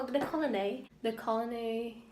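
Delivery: British-sounding colony ending in "ay"; retry colony in an accidental British accent. Real, not acted. Reader retrying a hard word, in a British accent. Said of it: "I have a British accent now".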